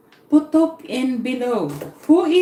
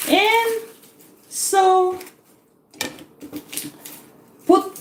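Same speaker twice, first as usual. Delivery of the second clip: plain reading voice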